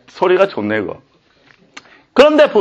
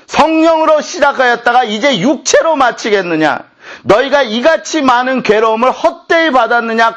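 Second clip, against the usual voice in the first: raised, ringing rebuke read from the Bible; scripture reading; 9 semitones higher than usual. Acted. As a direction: proclaim Paul's words as a stern challenge. Continues reading Galatians.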